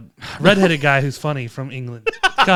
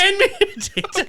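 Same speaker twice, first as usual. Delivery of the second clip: put-on higher, laughing stage-Irish accent; mock Irish accent; 14 semitones higher, 4 dB quieter than usual. Riffing on Irish clichés in a higher, laughing mock Irish accent. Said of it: "He's insane".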